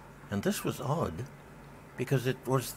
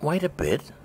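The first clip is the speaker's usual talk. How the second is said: higher, urgent little oyster cry; a touch of alarm, lightly voiced oysters; 8 semitones higher than usual. A narrator's reading. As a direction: oysters pleading with the walrus to wait, mildly urgent. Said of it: "the oysters cried".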